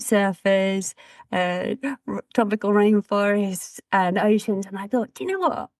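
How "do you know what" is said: high, bright lift on the question; spring the idea on them; a sudden playful notion; playful aside. Retold or imitated.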